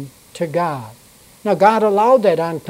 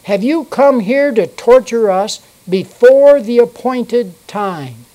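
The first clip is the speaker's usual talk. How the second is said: demons shouting; 5 semitones higher, 6 dB louder than usual. A narrator's reading. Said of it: "they shouted".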